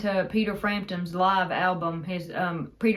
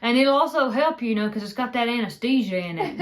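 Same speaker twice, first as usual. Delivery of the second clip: granddad impression; 4 semitones higher than usual. Retelling granddad's soap mix-up, granddad impression. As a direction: play granddad earnestly touting his soap. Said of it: "Yeah, he's like".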